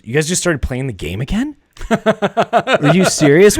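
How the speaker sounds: high, loud disbelief, drawn out at the end; retold outrage, climbing into a laughing exclamation; incredulous. Wife reacting to the game carrying on, incredulous.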